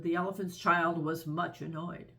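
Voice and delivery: plain reading voice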